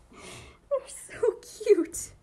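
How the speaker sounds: very high, slow, breathless whispered coo; whispered squeal. Real, not acted. Fan overwhelmed by a cute anime moment, whispered squeal. Cooing over a cute clip.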